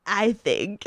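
normal talking voice

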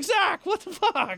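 high, slow stunned cry; shocked retort. Retold or imitated. Narrator to friend who cracked the windshield, stunned. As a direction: blurt it out in disbelief.

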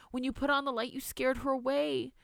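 put-on high, pleading little-girl voice; protesting child. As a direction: insist like a frightened child who needs believing. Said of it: "Maria Lucia protested".

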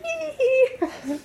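put-on very high, loud little-kid giggle; imitated kid's giggle. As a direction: squeal like a child being chased. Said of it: "And the kid's like".

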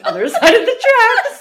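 loud and high, bursting into laughter midway; laughing shout. Real, not acted. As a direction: crack up while calling out the line. Teller cracks up at own wording.

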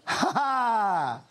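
loose, drawn-out "ha ha"; mock laugh. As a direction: act out a laugh at a bad impression. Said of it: "I laugh".

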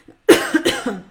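loud, rough real cough; chesty cough. Real, not acted. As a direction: clear the chest with a heavy cough. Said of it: "scusate la tosse".

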